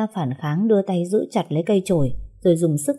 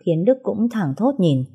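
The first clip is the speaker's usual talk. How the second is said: plain reading voice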